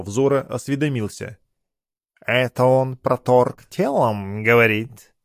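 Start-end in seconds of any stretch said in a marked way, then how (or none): 2.2-5.1 slow, disdainful cat voice; a fastidious cat asking in disgust; snooty cat. A narrator's reading. Cat character voice.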